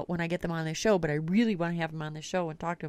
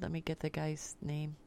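ordinary delivery

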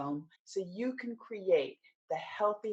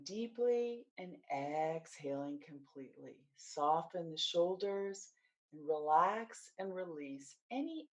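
guided meditation; 2 semitones lower, 4 dB quieter than usual. Acted. Coach leading listeners through mindfulness of the body, guided meditation. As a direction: guide them gently through the body, unhurried, settling softer.

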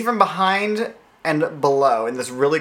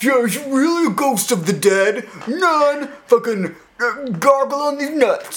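higher, slow hammed-up douchey guy; drawn-out mock douchebag, higher; 9 semitones higher, slower than usual. Cohost taunting the ghost, higher, slow douchey guy. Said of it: "douchey guy voices".

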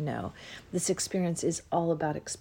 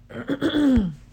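loud, rough throat clear; 7 semitones higher, 8 dB louder than usual. Real, not acted. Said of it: "My throat is just a little bit".

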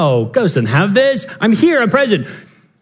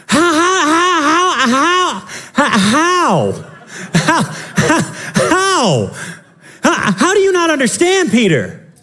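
high, strained, near-crying how, how; anguished how; 6 semitones higher, slower than usual. Acted. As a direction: plead with Peter in disbelief. Exasperated preacher crying out at Peter.

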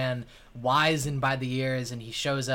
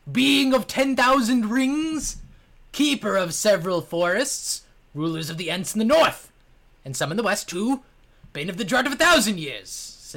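booming tree spirit; 9 semitones higher, 8 dB louder than usual. Acted. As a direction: proclaim like an ancient tree lord. GM voices pompous tree spirit.

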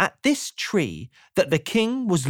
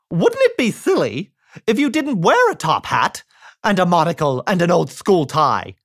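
Mister Silly voice; 2 semitones higher, 4 dB louder than usual. Acted. Mister Silly quizzing the chicken, loud and cheery. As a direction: ask it brightly, rising to the umbrella. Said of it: "he said to the worm".

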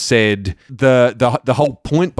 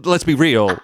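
ordinary talk

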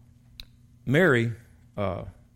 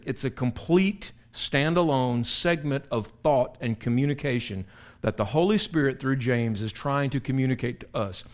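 ordinary talk, nothing marked

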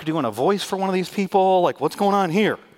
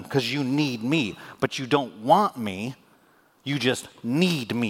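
deliberate slow reveal; God revealing their hearts, slowly; slow, weighty words; slower than usual. Acted. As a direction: let each phrase land slowly. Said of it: "he's revealing to them".

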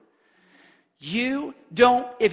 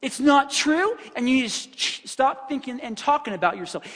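full-on rebuke; 5 semitones higher than usual. Retold or imitated. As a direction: snap at her to stop it. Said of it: "she just full on rebuked me".

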